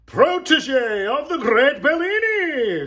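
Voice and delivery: high, loud ringmaster's announcement; shouting. A narrator's reading. Agent announces Mirette to the crowd, shouting. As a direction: proclaim it to the crowd at full voice. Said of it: "shouted the agent".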